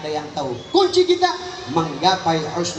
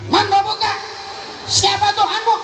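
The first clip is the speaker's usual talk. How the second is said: much higher, louder stern angel; angel questioning; 3 dB louder than usual. Retold or imitated. Grave angel questions the dead man, stern and booming. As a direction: demand it from above like an interrogating angel. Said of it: "Ditanya sama malaikat".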